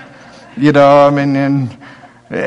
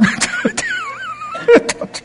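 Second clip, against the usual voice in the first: high burst of laughter; 3 dB quieter than usual. Real, not acted. Preacher laughing at his own story, high and bright. Laughs after retelling spanking.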